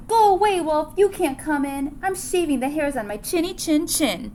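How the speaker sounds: high, loud shout through the door; snappy shout from inside; yelled back. Acted. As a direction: pig yelling at the wolf to leave. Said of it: "He yelled back".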